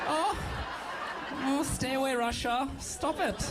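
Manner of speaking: mock pleading in a German-like accent; camp German-like accent. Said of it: "like a gayer German a little bit".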